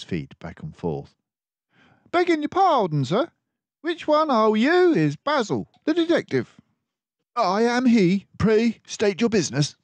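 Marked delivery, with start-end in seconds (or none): 2.1-6.6 much higher, louder timid mouse voice; a nervous common mouse begging pardon; nervous messenger mouse. Acted. Tubby messenger mouse at door. 7.4-9.8 much higher, louder crisp detective mouse; a composed mouse detective taking charge; Basil the detective. Acted. Basil answers the messenger.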